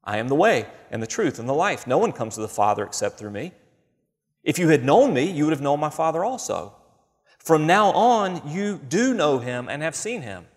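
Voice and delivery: solemn reading, slowing on the last lines; scripture reading. Acted. Preacher quoting Jesus to the congregation, solemn reading.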